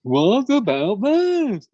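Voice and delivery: high, loud, very slow sing-song whine; Seinfeld impression. Mocking the Seinfeld style with a drawn-out comic whine. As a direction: stretch it out like a standup bit.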